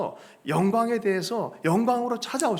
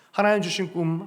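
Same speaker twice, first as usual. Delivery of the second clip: normal talking voice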